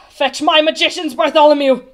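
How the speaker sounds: loud royal order; commanding. A narrator's reading. The stuck king orders Bartholomew to fetch magicians, commanding. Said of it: "he commanded".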